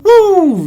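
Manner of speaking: high-pitched exhale of a woo; high loud "woo". Real, not acted. Host bracing for a heavy scene, high woo.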